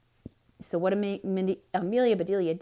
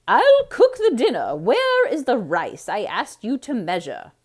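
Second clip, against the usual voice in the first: very high, loud, put-on lady's voice; the flustered wife takes charge; Missus Rogers; 4 semitones higher, 10 dB louder than usual. A narrator's reading. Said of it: "Missus Rogers speaks".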